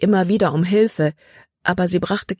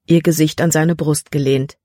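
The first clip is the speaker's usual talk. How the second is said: ordinary delivery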